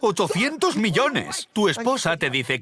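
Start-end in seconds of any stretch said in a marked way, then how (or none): 0.0-1.5 slow, higher, wondering; amazed. Retold or imitated. Telling himself about the fortune, slow amazed wonder. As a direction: repeat it, still unable to believe.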